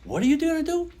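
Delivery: high, loud, alarmed question; wife alarmed. Retold or imitated. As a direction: ask in sudden alarm. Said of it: "she said".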